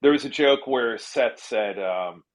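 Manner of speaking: usual speaking voice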